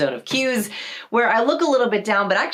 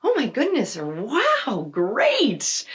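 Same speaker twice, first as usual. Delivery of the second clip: excited reaction; 5 semitones higher, much slower than usual. Retold or imitated. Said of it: "They're like".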